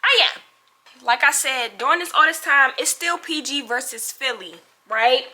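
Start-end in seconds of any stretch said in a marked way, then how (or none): 0.0-0.4 loud, high, sassy hype-up; brassy reality TV bravado, higher and louder; Bad Girls Club attitude. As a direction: channel a reality-show diva egging on the crowd. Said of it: "I gotta bring the B G C Cajun out".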